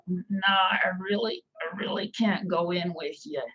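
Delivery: lightly put-on joke character voice; reluctant date. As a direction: voice the guy begging off coming inside. Joke character nervously refusing a date.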